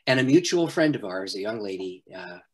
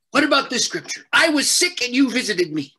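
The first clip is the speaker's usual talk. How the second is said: higher, louder, retelling an angry friend scolding him by full name; 12 semitones higher, 6 dB louder than usual. Retold or imitated. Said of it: "she got really mad".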